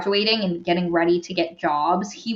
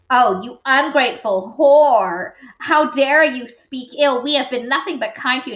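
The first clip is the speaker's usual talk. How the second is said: hammed-up offended authority, higher pitch; indignant scolding; 4 semitones higher, 3 dB louder than usual. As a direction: berate them with theatrical indignation. Retold outraged headmaster berating student.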